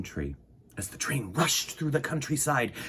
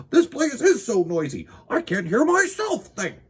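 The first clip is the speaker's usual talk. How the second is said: high, loud frustrated cry; 11 semitones higher, 5 dB louder than usual. Acted. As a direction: shout it over the clocks. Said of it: "he cried".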